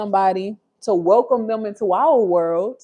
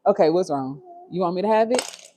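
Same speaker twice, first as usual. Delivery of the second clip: ordinary talk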